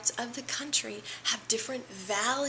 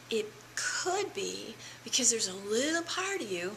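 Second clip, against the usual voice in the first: ordinary talk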